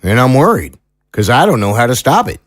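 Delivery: country lawman with mild concern; folksy small-town sheriff, worried. Acted. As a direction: play a sheriff owning up to worry. Sheriff figure admits worry.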